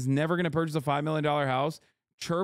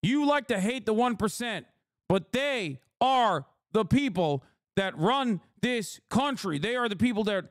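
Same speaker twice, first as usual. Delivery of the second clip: higher, hammed-up indignant pundit voice, pushing the talking points; mock Trump Republican; 4 semitones higher than usual. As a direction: play an offended Trump Republican defending the rich. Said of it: "put my fucking Trump Republican hat on".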